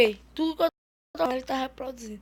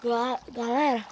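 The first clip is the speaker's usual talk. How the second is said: usual speaking voice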